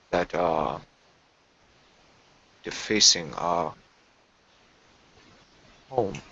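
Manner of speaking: hushed, formal servant creature. Acted. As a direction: ask your master with uneasy formality. Player voices his creature.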